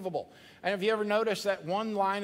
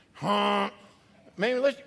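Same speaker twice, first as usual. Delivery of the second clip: ordinary delivery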